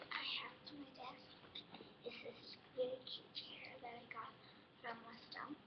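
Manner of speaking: whispering over a crib. Real, not acted. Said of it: "I have to be quiet because the baby's sleeping".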